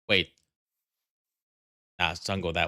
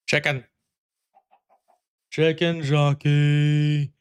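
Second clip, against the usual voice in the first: slow, loud, higher stretched chicken jockey; movie line shout; 6 semitones higher, 6 dB louder, much slower than usual. Pretend. Streamer yelling the movie meme line, slow and loud. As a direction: belt it like the famous meme line. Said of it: "Hey, I said the thing".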